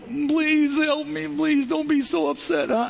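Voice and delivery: high, slow pleading plea; pleading cashier. Retold or imitated. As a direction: imitate a nervous new cashier begging for patience. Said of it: "they're looking at me like".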